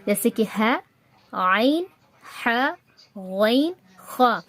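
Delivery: each Arabic letter spaced out and made in the throat. Shown as a demonstration. Teacher listing the letters that come from the throat, carefully articulated. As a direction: articulate ح، عین، غین، خا from the throat. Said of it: "جو کہ حلق سے ادا ہوں گے".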